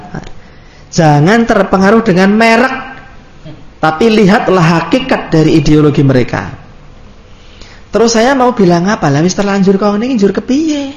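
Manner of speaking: usual speaking voice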